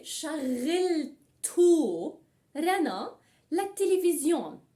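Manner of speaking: word by word, with long pauses. Shown as a demonstration. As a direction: break the sentence up for learners. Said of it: "Then I can say".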